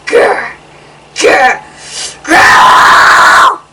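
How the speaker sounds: loud rasping monster growl. Pretend. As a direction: growl like a hidden beast. Scary growl in the forest.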